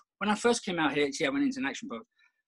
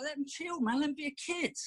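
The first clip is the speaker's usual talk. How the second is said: pleading softly; 9 semitones higher, 5 dB quieter than usual. Retold or imitated. Pleading with wife for son.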